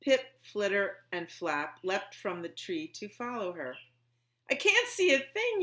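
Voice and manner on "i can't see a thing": high, loud panicked yell. A narrator's reading. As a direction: shout it out blind in the dark. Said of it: "yelled Pip".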